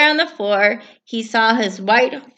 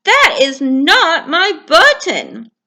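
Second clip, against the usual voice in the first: higher, louder, slow, sharper; irritated Toad; 9 semitones higher, 9 dB louder, slower than usual. Acted. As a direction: push it away crossly. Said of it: "said Toad".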